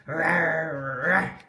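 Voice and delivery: hammed-up rawr, rawr growl; mock fierce growl. Poppy growling at George with a sock in her teeth, mock fierce. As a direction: growl as fierce as a muffled dog can. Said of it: "Poppy let out a fierce growl".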